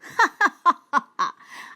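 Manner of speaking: loud booming laugh; lion laughing out loud. Acted. Lion laughing at the tiny mouse, loud and booming. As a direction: let out a big proud laugh. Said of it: "The lion laughed out loud".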